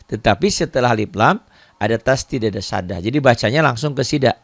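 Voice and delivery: ordinary talk, nothing marked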